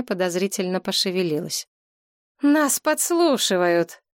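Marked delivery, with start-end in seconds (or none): 2.4-4.0 high, slow alarmed exclamation; exclaiming. A narrator's reading. Galina noticing the curtain, slow alarmed exclamation. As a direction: call it out as you spot the curtain move. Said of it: "воскликнула она".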